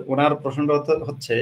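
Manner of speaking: ordinary delivery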